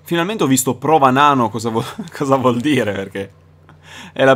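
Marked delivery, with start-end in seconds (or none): none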